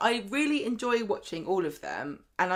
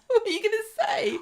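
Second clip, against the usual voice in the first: laughing; 4 dB louder than usual. Real, not acted. Laughing while pressing co-host.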